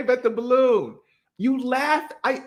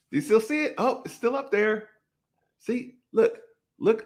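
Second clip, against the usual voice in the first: ordinary talk, nothing marked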